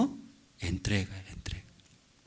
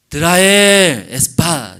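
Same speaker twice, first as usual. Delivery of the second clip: mandato real lento y fuerte, más agudo; orden del rey; 5 semitones higher, 10 dB louder, much slower than usual. Acted. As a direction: ordena como un rey que exige la espada. El rey ordena traer la espada. (orden fuerte y lenta del rey)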